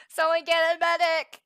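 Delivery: loud joking call for help; man down shout. Pretend. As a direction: shout for a medic, laughing. Calling for a medic jokingly.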